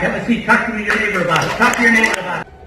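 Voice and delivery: loud, high call to the room; calling out instructions. Real, not acted. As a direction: project over a noisy group.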